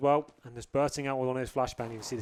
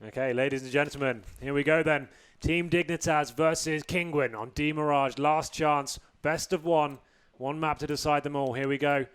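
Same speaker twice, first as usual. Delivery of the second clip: louder, higher announcer intro; match announcer; 3 semitones higher, 5 dB louder than usual. Real, not acted. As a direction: build up the showdown for the crowd.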